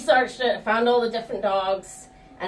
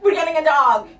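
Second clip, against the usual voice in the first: excited announcement; 6 semitones higher, 7 dB louder than usual. Retold or imitated. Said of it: "all excited".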